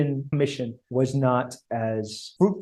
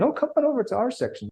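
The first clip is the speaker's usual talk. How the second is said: much higher and quick, a coaxing singsong; mock eager British; 11 semitones higher, faster than usual. The British still coaxing the Americans over, high quick mock voice. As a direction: wave them over like a pushy host. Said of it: "the British are still saying".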